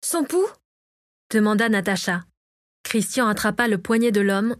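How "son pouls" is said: much higher, rising question. A narrator's reading. Natacha asks about the unconscious man's pulse, urgent question. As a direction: lift the voice into a quick worried query.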